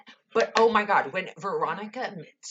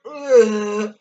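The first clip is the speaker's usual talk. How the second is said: disgusted ugh; 4 semitones higher, 11 dB louder than usual. Real, not acted. Disgusted by her mom.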